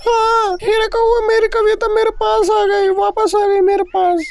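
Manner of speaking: beam with happiness at her return; overjoyed mother. Acted. Noni bird tells Hira crow her Kavita is back, overjoyed.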